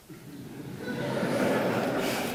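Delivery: a short airy laugh rising up; amused high laugh; high breathy laugh. Real, not acted. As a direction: laugh lightly at the irony. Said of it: "laugh heard".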